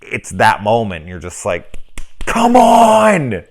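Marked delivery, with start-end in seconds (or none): 2.2-3.4 high, loud drawn-out come on; exasperated shout. Retold or imitated. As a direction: groan out loud at a stupid decision. Yelling at a dumb movie moment.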